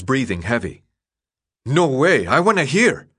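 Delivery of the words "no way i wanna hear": stubborn protest. A narrator's reading. Newt refusing to leave.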